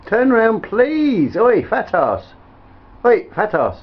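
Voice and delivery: loud, high-pitched plea for attention; insistent calling. Real, not acted. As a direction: call out sharply to be noticed. Calling insistently to make them turn.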